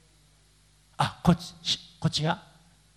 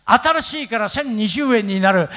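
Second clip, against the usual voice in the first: ordinary talk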